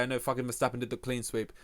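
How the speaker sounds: usual speaking voice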